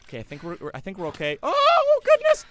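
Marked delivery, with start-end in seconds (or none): 1.4-2.5 very high, loud startled cry; startled shriek. Real, not acted. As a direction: jump out of your skin and shriek. Sudden scare in game.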